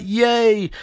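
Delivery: high imitated hooray; little hammed-up echo of a cheer; mock cheering. [name] answers Ronnie's return, mock cheering.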